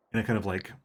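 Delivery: normal talking voice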